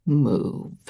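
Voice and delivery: low threatening rasp. A narrator's reading. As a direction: growl it quietly as a threat. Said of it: "a low, threatening rasp".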